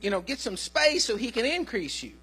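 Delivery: ordinary talk, nothing marked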